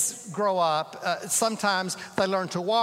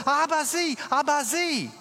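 put-on a small boy's high, louder, eager call; pointing at the bird, eager; excited toddler; 8 semitones higher, 3 dB louder than usual. Little boy calls to his father, high and excited. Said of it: "the little boy says".

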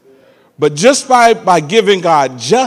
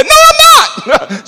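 put-on defiant little boy; 7 dB louder than usual. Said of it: "I said".

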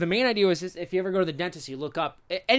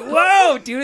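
startled whoa; 10 semitones higher, 10 dB louder than usual. Retold or imitated. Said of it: "You're like".

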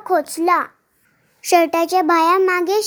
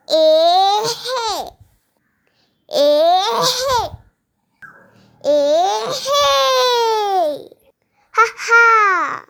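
slow stretched higher heaves, half laughing; straining to pull; 4 semitones higher, much slower than usual. A narrator's reading. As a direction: strain hard then burst free. Final pull before radish comes out. (मुळा उपटताना जोराचा हिसका)

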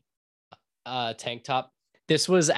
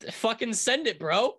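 frat bro; 9 semitones higher than usual. Pretend. Hyped bro cheer.

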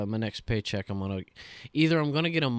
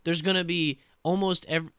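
normal talking voice

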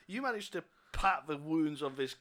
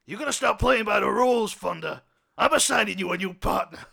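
louder stern captain laying down rules; 3 semitones higher, 10 dB louder than usual. Acted. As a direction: warn the cop sternly. Captain lectures cop.

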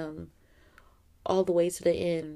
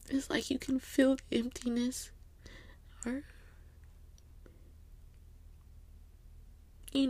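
higher, unvoiced grieving whisper; tearful whisper; 6 semitones higher, 6 dB quieter than usual. Real, not acted. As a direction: whisper through held-back tears. Grieving the empty gatherings.

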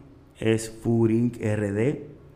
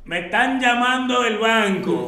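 much higher, louder, slow worried father voice; alarmed father; 9 semitones higher, 6 dB louder, slower than usual. Retold or imitated. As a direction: play the father, panicking about the bank. Father voiced, alarmed about bank calls. (papá alarmado, voz aguda y fuerte)

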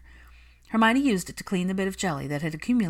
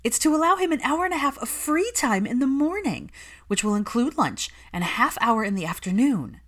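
much higher, eager nanny voice; giddy excitement; 7 semitones higher, 4 dB louder than usual. A narrator's reading. Nanny gushes to Hermione about his free time, excited. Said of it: "Catherine was giddy with excitement".

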